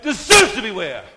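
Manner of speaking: shouting back, high and loud; loud defiant question. Retold or imitated. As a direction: challenge the voice in the dark. Retold reply in confrontation.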